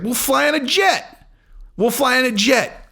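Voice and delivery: high, drawn-out cocky answer; breezy mock boast. Champion telling friends how they'll travel, high mock-boastful voice.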